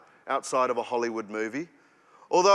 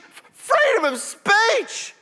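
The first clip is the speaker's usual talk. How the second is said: much higher, louder, slower screeched slogan; hammed-up shriek; 5 dB louder, slower than usual. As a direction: scream it like a frenzied rally crowd. Repeated slogan, bigger.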